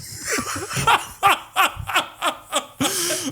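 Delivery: high, wheezing laugh in bursts; helpless laughter. Real, not acted. Teller reaching the ripped pants moment, helpless laughter. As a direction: crack up at your own story, squealing.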